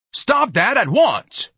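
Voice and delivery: very high, slow, loud bellow. Acted. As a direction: bellow the order. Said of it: "Ignatius bellowed".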